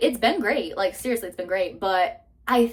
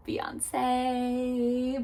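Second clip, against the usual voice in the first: playful aside; 4 semitones higher, 4 dB quieter than usual. Pretend. Joking name for sister's girlfriend.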